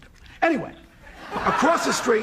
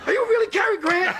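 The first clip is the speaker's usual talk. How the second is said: starstruck; 9 semitones higher than usual. Retold or imitated. Starstruck greeting to Cary Grant.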